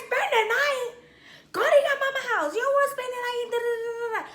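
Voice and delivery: high-pitched, scornful refusal; indignant retold rant. Girlfriend refusing boyfriend the night, high indignant rant. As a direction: send him packing, trailing into nonsense syllables. Acted rejection of his request.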